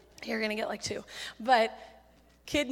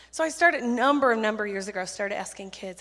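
usual speaking voice

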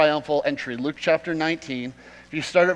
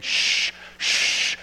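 shushing. Retold or imitated. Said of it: "they kept saying".

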